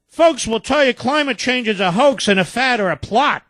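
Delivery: a hint of a mocking presidential voice. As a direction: ape him back at him, sneering. Mimics the president's clip line.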